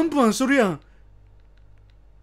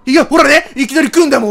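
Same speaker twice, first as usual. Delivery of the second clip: loud, alarmed protest; startled outburst; 3 semitones higher, 10 dB louder than usual. Real, not acted. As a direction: yell out as the scare hits. Sudden scare happens.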